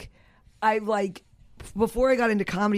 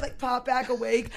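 ordinary talk

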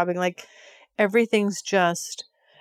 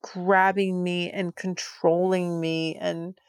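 usual speaking voice

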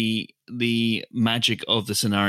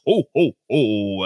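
Santa laugh; 3 semitones lower, 4 dB louder, slower than usual. Shown as a demonstration. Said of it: "that's what Santa sounds like".

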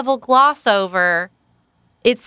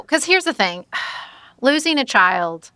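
usual speaking voice

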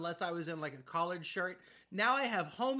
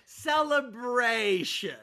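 drawn-out correction; 3 semitones higher, 6 dB louder, much slower than usual. Retold or imitated. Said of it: "Betsy and I keep going".

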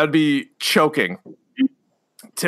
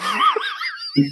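high squealing burst of laughter; shrieking laugh. Real, not acted. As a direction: let out a helpless shriek of a laugh. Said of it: "how fucking hard you're laughing".

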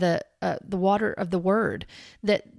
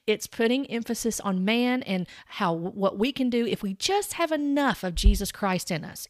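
ordinary talk, nothing marked

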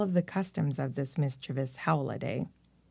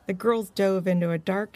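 ordinary delivery, nothing marked

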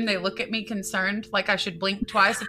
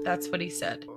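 usual speaking voice

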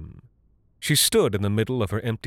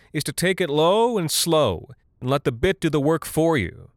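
raised, higher pitch over machine noise; mild shout; 7 semitones higher than usual. A narrator's reading. Woman instructing him over the mechanism's whine, mild shout. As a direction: project lightly over a whining drill. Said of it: "she shouted over the mechanism's whine".

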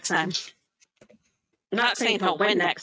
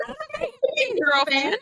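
mock Shogun; much faster than usual. Retelling what Shogun said.

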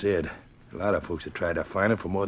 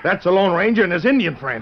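high, loud alarmed cry; alarmed exclamation; 12 semitones higher, 10 dB louder than usual. Acted. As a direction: blurt it out in shock. Recognizing the lawman in alarm.

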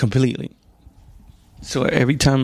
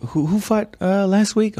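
high, bright mock question; asking friend; 10 semitones higher than usual. Imitating a friend asking about last week's fight, high. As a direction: ask it eagerly, pitched up. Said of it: "But if you ask me".

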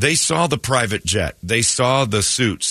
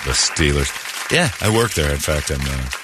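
smooth con man; 7 semitones lower than usual. Pretend. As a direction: lay the lie on thick and low. Plays the scammer answering her.

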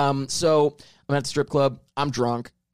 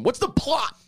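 retold drunk yell; 11 semitones higher, slower than usual. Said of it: "I was yelling at him".

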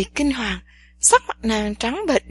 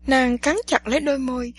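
ordinary delivery

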